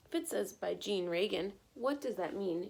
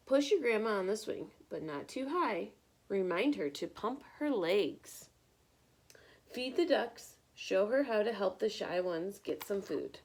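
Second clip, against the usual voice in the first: lively picture-book read-aloud; bright, expressive storytime reading; reading to children; 3 dB louder than usual. Acted. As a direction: read it like storytime for little ones.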